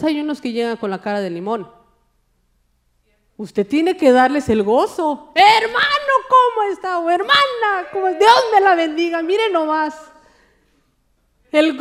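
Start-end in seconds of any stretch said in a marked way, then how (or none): 5.3-10.0 much higher and louder, beaming hello; bright welcome to a sour-faced churchgoer; cheerful greeting. Acted. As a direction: greet a glum brother like you're thrilled to see him.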